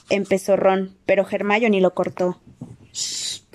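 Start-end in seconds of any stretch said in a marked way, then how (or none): 2.9-3.4 sharp whispered shush. Acted. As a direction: hush him with a breathy shh. Hermione cuts Ron off.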